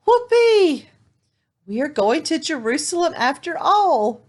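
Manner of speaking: high, loud whoop of delight; overjoyed whoop. Acted. Little donkey cheering that he will go to Jerusalem, overjoyed. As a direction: leap for joy in your voice.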